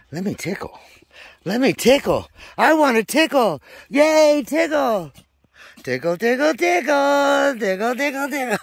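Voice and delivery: high loud squeal, playful; shrieking tickle, tickle; squealing pet talk. Real, not acted.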